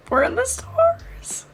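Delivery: high, slow dreamy reading of the line; high dreamy mock-romantic delivery; swoony quote. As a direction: breathe it out like a lovestruck fan. Quoting a swoony line from the book.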